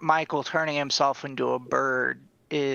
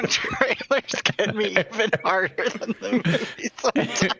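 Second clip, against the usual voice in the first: mock quote; 6 semitones higher than usual. Mocking repeat of Drew's line.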